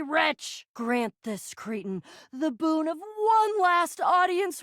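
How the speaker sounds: slow, hammed-up theatrical supplication; grandiose grovelling. Begging mercy from a mighty villain, theatrically abject. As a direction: declaim it slowly like an overblown plea. Mock grovelling plea to the pirate.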